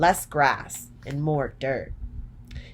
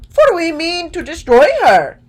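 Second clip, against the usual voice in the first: Scarecrow's retort; 13 semitones higher, 12 dB louder than usual. A narrator's reading. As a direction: draw it out big and bold as the Scarecrow. Scarecrow's line in read story.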